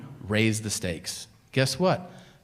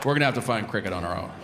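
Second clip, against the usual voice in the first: whitest detective; 6 semitones lower, much faster than usual. Retold or imitated. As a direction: mutter it like a timid office detective. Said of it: "the whitest detective".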